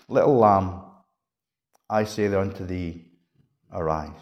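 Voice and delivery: low, soft, hushed words; gentle. Acted. Jesus to the sleeping little girl, gentle. Quiet reading of the gentle version.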